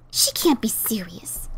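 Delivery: slow, low, stunned whisper; shocked whisper. Acted. Ditzy stunned by her sister's letter, whispering slowly. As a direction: breathe it out in disbelief.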